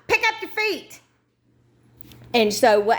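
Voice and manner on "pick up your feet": high-pitched mock yell at her shuffling dad. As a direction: snap it at him from a few steps behind. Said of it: "and I'm like".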